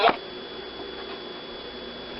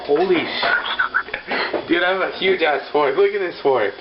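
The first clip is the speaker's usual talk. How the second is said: excited; 6 semitones higher, slower than usual. Real, not acted. Shocked by a huge fork.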